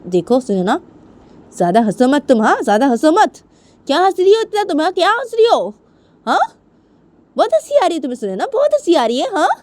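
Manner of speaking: high, loud telling-off; angry scolding mother. Acted. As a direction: scold the laughing daughter, voice climbing. (बेटी को ज़ोर से डाँटती माँ)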